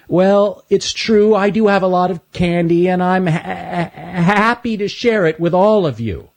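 high, halting stuttering voice; nervous but willing to share; Stanley's stutter. Acted. Stanley tells the animals he'll share, stammering high.

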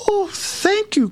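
high, drawn-out delighted cry; overjoyed. A narrator's reading. Princess gets her ball back from the frog, overjoyed. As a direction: squeal your thanks slowly and high. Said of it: "cried the princess, overjoyed".

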